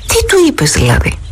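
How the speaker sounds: half-startled query, voiced lightly; surprised. Boy asks his friend what he told, surprised. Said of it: "ρώτησε ξανά έκπληκτος".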